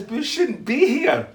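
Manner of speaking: retold alarm, played for laughs; alarmed protest. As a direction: urge a companion to get out.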